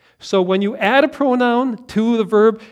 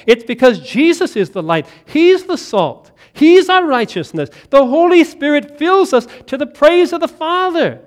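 higher and louder, proclaiming each line; full-force preaching; 7 semitones higher, 8 dB louder than usual. Acted. Preacher proclaiming Christ to the congregation, full force. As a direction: ring it out like a pulpit climax.

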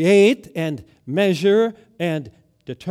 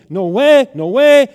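Peter's loud, higher no-way protest; 6 semitones higher, 11 dB louder than usual. Retold or imitated. Peter refusing Jesus's warning, loud and higher. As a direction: shout it down in flat refusal.

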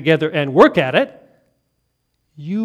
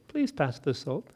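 soft still small voice; 5 semitones lower, 10 dB quieter than usual. Acted.

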